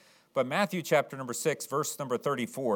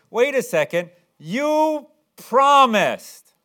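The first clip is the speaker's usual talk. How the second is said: loud, high-pitched mock complaint; imitating an indignant child holding you to it; whining protest; 11 semitones higher, 10 dB louder, much slower than usual. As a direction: protest like a let-down kid, drawing out the words. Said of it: "They usually say it kind of whining like".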